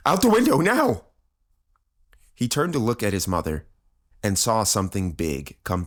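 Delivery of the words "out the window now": high, loud, desperate order; frantic yelled command; urgent shout. A narrator's reading. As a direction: shout them toward escape. Father shouts to flee from attackers.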